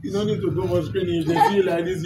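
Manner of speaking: usual speaking voice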